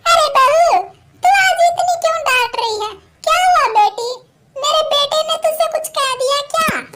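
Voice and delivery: put-on a confused old mother asking why she is scolded; hurt old woman. Mother-in-law asks why her daughter-in-law scolds her, plaintive old voice.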